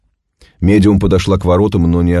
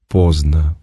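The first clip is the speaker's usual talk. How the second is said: detached; 2 semitones lower than usual. A narrator's reading. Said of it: "отрешённо сказала медиум".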